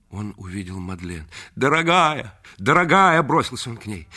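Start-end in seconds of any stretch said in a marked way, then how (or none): 1.5-3.2 high, loud, breathless endearment; rapturous cry. Acted. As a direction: cry out her name with desperate love. Reggie rushes to Madeleine.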